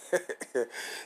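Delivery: amused little laugh; quick breathy laugh; short chuckle. Real, not acted. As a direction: chuckle to yourself mid-sermon. Laughs after quoting the elder.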